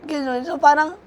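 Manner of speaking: ordinary talk, nothing marked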